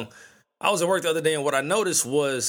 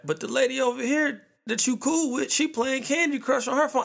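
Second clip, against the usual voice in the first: higher, whining coworker; whiny complaint; 9 semitones higher than usual. Retold or imitated. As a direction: tattle like a wronged kid. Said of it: "you be like".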